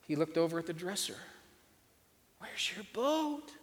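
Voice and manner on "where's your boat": gentle question. A narrator's reading. Father asks gently.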